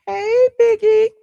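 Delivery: high, drawn-out loud call; calling the dog. Real, not acted. Streamer calls her dog over as he comes, high calling voice. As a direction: sing out the pet's name, high and slow.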